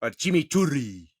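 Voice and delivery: chimichurri with a Spanish r, chee-mee-CHOO-rree; mock Spanish chimichurri; Spanish accent. Shown as a demonstration. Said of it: "Spanish accent".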